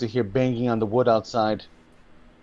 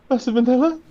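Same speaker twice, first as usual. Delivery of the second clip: high-pitched, louder questioning servant; servant to her master; 12 semitones higher, 3 dB louder, faster than usual. Acted. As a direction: call up to your master in a high voice. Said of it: "you see the Migonette run to her master".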